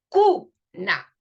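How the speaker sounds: "cuna" with CU punched loud, "na" apart; stressed syllable. Shown as a demonstration. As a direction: hit the U in "cuna" hard. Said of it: "el fonema que se acentúa".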